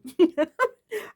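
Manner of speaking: a short high burst of laughter; amused laugh. Real, not acted. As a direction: laugh at the sorry state of the carrots. Laughs at the old carrots.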